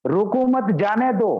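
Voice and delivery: "रोको मत" joined, then "जाने दो"; group "मत" with "रोको", then release "जाने दो" with a fall; pause after मत. Shown as a demonstration. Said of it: "यहाँ का टोन चेंज है". ("रोको मत" साथ, फिर "जाने दो")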